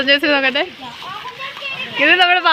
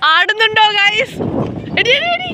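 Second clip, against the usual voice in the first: rising to a high squeal; thrilled yelps on a swaying bridge; excited squeal; 8 semitones higher than usual. Real, not acted. Shaky hanging bridge, rising alarm.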